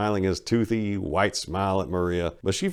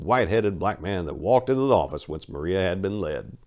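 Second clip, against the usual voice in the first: ordinary delivery, nothing marked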